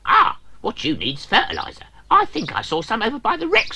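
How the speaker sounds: high, fast chirpy voice; helpful drill. Acted. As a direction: suggest it brightly. The drill gives advice.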